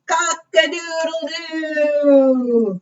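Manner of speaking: high, long drawn-out crow; rooster crow. Acted. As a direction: crow it slowly, holding the end. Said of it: "crowed the rooster".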